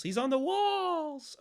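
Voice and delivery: high, slow, drawn-out teasing; high sing-song. Acted. Repeats where the monster is.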